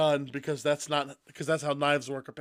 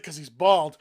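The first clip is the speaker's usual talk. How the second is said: ordinary delivery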